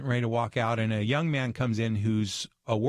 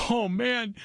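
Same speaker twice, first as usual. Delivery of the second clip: overjoyed relief, voice shooting up; grateful young man; 14 semitones higher than usual. Retold or imitated. Young restaurant worker thanks the speaker, high gushing voice. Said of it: "He goes".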